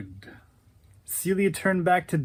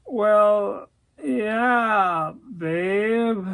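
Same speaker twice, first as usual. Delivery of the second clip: halting, drawn-out reply, higher pitched; stuttering hesitation; 5 semitones higher, 3 dB louder, much slower than usual. Acted. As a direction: falter between each word. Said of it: "He stuttered".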